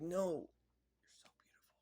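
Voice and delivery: breathy, high, put-on whisper; awed whisper. She refuses to end her young self, whispering high. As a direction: whisper it in awe. Character admiring young body.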